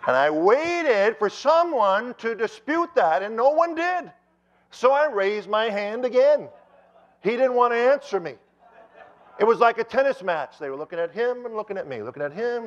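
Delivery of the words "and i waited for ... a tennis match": high, loud storytelling from the pulpit; raised preaching voice. Acted. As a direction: belt it out to the whole congregation.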